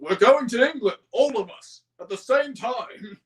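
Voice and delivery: insistent Fat Controller. Retold or imitated. Fat Controller insists to his engines, high and loud. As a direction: lay down the law.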